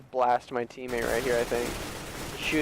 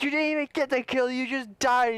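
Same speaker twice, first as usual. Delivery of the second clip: exasperated gamer rant, pitched up and held back; mock angry scolding; 9 semitones higher than usual. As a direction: re-enact chewing out a teammate. Retold yelling match with teammate.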